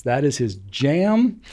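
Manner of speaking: ordinary talk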